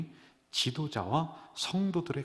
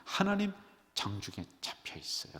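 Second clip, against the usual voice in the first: ordinary talk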